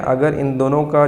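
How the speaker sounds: normal talking voice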